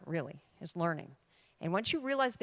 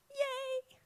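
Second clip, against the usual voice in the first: a squeaky, high yay; high cheer; 4 dB quieter than usual. Real, not acted.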